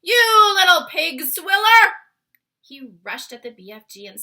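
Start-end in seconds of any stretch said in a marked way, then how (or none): 0.0-1.9 furious giant roaring at the BFG; roared insult. A narrator's reading. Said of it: "roared the blood bottler".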